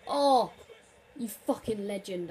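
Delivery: loud joyful shout; excited cheer. Real, not acted. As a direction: cheer your player on. Scores again.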